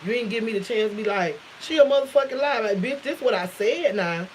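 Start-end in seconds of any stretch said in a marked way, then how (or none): 1.6-2.6 louder, higher mock denial; indignant retort. Teller acting out the denial she never got to make, indignant.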